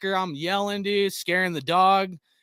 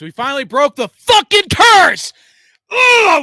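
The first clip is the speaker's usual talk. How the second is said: cracking joyful yell; 11 semitones higher, 14 dB louder than usual. Real, not acted. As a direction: voice breaking into a joyful cry. Overjoyed about beating the Patriots.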